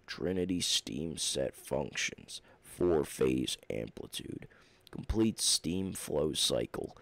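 very low, slow machine announcer; slow deep robot voice. Acted. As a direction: intone it like a droning machine. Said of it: "I like to go into different accents".

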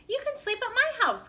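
high, friendly call. A narrator's reading. Beaver offering the homeless friends his house, calling high. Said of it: "called Beaver".

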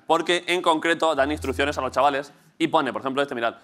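usual speaking voice

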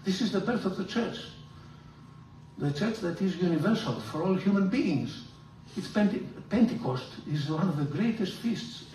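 usual speaking voice

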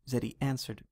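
plain reading voice

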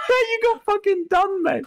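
high-pitched, laugh-cracked gloating; laughing hard. Real, not acted. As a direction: crow through laughter at the prank. Laughing at pranked friend.

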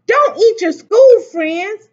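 high, loud, slow protest; crying out. A narrator's reading. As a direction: plead loudly like dismayed children. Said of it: "cried the children".

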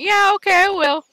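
loud, chirpy brush-off; breezy fake promise. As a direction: toss off a cheery empty promise. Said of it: "and I keep going".

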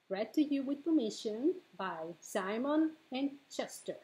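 usual speaking voice

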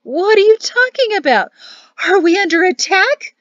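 put-on loud, panicked old woman; shrieked, very high questions; high-pitched alarm. A narrator's reading. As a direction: cry out as if under attack. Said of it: "Serafina's voice pitched higher".

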